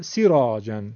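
سیراجن with 'ee' cut to short 'si'; shortened ya. Shown as a demonstration. Teacher showing kasra as short ya, brief 'si' in سیراجن. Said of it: "ایشو کوتاه کرده".

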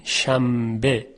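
شنبه drawn out slowly as shambe. Shown as a demonstration. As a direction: stretch شنبه with m before b.

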